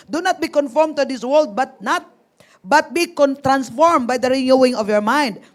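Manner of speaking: loud, emphatic Bible reading; scripture reading. Acted. Pastor reading the verse aloud to the group, emphatic. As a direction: read the verse out to the group, firmly.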